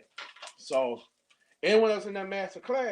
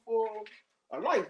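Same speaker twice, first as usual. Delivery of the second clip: usual speaking voice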